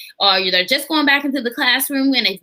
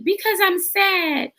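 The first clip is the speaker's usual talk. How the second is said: put-on high, slow, pouty child voice; little son sulking; 7 semitones higher, slower than usual. Mother voicing her son, slow and pouty. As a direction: whine it like a sulky little boy.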